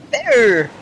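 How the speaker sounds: a touch of British TV comic; Benny Hill. Acted. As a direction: slide down through the word in mild mimicry. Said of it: "He talked like that".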